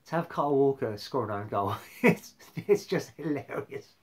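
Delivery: loud laughing disbelief. Real, not acted. Vlogger laughing about a rival's own goal.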